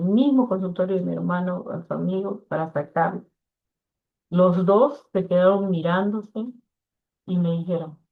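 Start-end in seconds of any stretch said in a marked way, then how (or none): none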